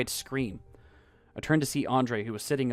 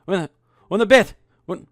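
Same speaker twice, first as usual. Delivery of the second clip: panicked, much higher and louder stammer; terrified man pointing at the floor; trembling with fear; 9 semitones higher, 16 dB louder than usual. Acted. Andre points at the floor, stammering in loud panic. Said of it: "His voice was trembling as he said".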